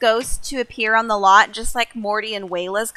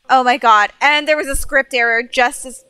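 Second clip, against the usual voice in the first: normal talking voice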